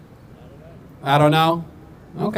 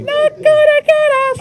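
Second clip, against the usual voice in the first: mock high voice; 5 dB louder than usual. Roasting tourists, mock squeaky voice.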